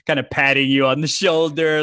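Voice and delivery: normal talking voice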